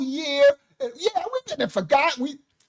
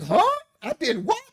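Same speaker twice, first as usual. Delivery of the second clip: mock surprise; 8 semitones higher than usual. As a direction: play dumb, shocked at the news. Retelling being accused of moving.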